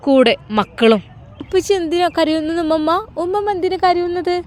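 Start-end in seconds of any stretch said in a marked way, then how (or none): 1.4-4.4 put-on high little child's voice, innocently asking; a small child's chirpy question; child's babble. A narrator's reading. Grandchildren asking grandma why everyone cries, childlike high voice. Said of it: "കിളിക്കൊഞ്ചൽ".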